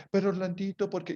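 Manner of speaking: a boss asking, incredulous; boss's surprise. Retold or imitated. Imita al jefe sorprendido. (el jefe, muy agudo e incrédulo)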